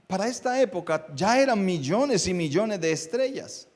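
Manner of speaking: high, forceful sermon delivery; impassioned preaching. Real, not acted. Preacher telling congregation of the wise men's star, impassioned preaching. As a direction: preach it out with rising, ringing emphasis.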